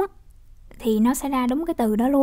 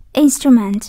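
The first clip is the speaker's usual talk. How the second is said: IN-strument, first syllable louder; stress on first syllable; 7 dB louder, slower than usual. Shown as a demonstration. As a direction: say "Instrument" slowly, hitting IN. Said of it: "nhắn âm một". (nhấn mạnh âm đầu của "Instrument")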